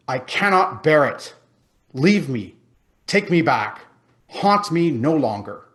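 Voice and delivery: loud, desperate cry; exclaimed. A narrator's reading. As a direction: cry out to be freed. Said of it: "Scrooge exclaimed".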